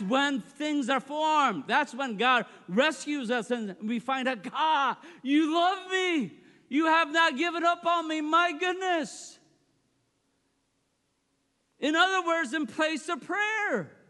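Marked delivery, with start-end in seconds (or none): 4.4-9.4 acted astonished relief; amazed gratitude. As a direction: marvel aloud, stretching the key words. Acted amazed prayer on being rescued.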